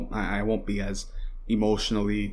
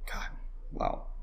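ordinary talk, nothing marked

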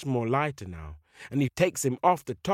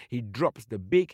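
ordinary delivery, nothing marked

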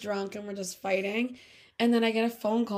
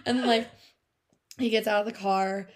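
usual speaking voice

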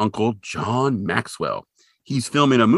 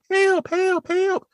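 very high mock Southern cries; Southern accent; much slower than usual. Podcaster imitating Candy crying for help, high Southern accent. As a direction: squeal it with a hammed-up Southern twang. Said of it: "in her Southern accent".

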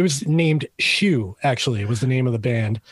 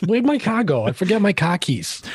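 higher-pitched Boston accent, Rs dropped; 5 semitones higher than usual. Shown as a demonstration. Guest playing Dave in the yard, Boston accent. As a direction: give it some Boston street talk, no Rs. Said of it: "let that Boston sneak out for a second".

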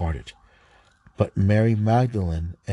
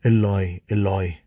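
a louder cry, not a full scream; 4 dB louder than usual. Acted. Jesus crying out from the cross, loud cry. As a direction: call out Eloi with a raised voice. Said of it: "cried out in a loud voice".

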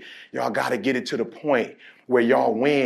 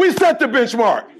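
call and response; 9 semitones higher, 6 dB louder, slower than usual. Real, not acted. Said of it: "Say it with me".

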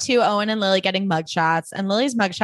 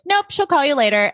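mild curt dismissal; 6 semitones higher, 4 dB louder than usual. Retold or imitated. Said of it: "And Carol's just like".

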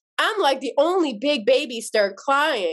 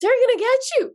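higher, brisk protective reassurance; reassuring cousin; 9 semitones higher than usual. Retold or imitated. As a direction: back her up but warn her.

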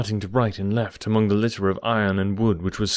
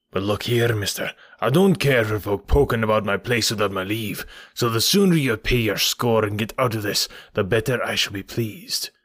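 menacing innkeeper character voice; hostile, grudging landlord laying down the law; surly landlord. Acted. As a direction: warn off snoopers as a rough landlord.

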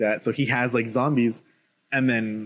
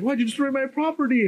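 high-pitched mock complaint; whiny brat; 13 semitones higher than usual. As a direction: imitate a spoiled kid protesting. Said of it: "he's like, he's a brat".

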